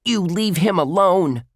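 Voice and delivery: loud, high protective cry; girl crying out to defend her friend; cried out. A narrator's reading. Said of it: "Ruth Rose cried".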